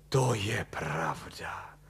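slow, whispery sigh of realisation; exhaled in relief. A narrator's reading. Husband seeing his wife is right, exhaling. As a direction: breathe it out slowly. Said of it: "odechl si Kalous".

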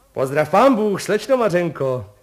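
high, loud hearty hello; a postman hailing a girl at her window, loud and high; called out greeting. A narrator's reading. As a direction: call the greeting across to her. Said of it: "zvolal pan Kolbaba".

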